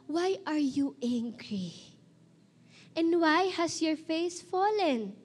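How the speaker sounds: slow, emphatic, spaced-out delivery; preaching scripture reading. Acted. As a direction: proclaim the verse phrase by phrase, with weight. Reads scripture to listeners.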